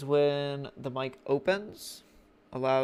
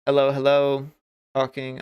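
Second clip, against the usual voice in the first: louder, belting a mic test; loud mic check; 10 dB louder than usual. Shown as a demonstration. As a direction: call hello hard into the mic to check levels. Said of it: "I'm quite loud at this point".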